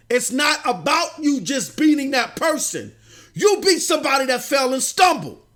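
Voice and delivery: fired-up gamer shouting his demand; real rising rant. Real, not acted. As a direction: let the frustration boil over into yelling.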